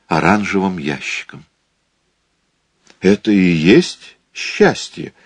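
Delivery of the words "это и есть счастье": slow, doubting question; lightly voiced wary wife; doubtful. A narrator's reading. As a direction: ask as if you don't believe it. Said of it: "недоверчиво спросила она".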